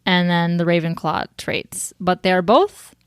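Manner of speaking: ordinary talk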